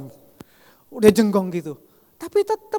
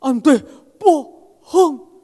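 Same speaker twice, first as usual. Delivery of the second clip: put-on high, loud childlike protest; childlike "you lied" protest; Wahyu accusing; 6 semitones higher, 9 dB louder, much slower than usual. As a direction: call him a liar like a disappointed child. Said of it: "Orang panti asuhan tahu kayak gitu".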